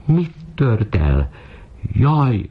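high-pitched wail at normal volume; horrified mistress's high squeal; shrieking. A narrator's reading. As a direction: shriek it high and drawn out, without much volume. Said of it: "sivított".

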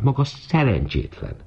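high, contained shriek; shrill scolding without much volume. A narrator's reading. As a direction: squeal the reproach at the maid, high-pitched. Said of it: "sivított".

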